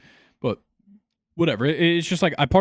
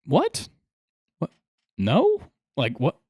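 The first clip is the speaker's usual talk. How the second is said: baffled disbelief; slower than usual. Real, not acted. Stunned reaction to odd question.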